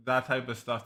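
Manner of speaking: ordinary talk, nothing marked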